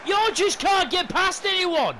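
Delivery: high, loud, slow yell at a player; angry shout. Real, not acted. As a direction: bellow at the useless winger. Yelling at player De Paul.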